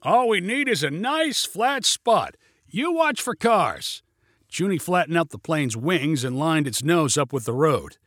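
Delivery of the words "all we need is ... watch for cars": high, projected directions; calling instructions. A narrator's reading. Pilot instructs passenger before landing, high call. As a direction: call it out pitched up over noise.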